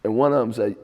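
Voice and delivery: normal talking voice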